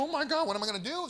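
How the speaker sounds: hammed-up frantic homeowner; mock panic. Family panics at infestation.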